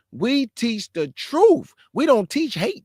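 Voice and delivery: ordinary talk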